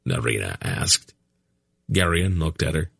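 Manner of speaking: ordinary delivery, nothing marked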